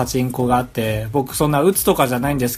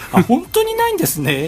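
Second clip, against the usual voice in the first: high, polite voice for a phone call; a caller politely asking a company; high-pitched phone politeness; 13 semitones higher than usual. Retold or imitated. His reply on the phone.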